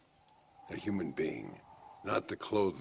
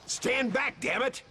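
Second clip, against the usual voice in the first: urgent shout; 4 dB louder, slower than usual. Acted. Warning others of a blast.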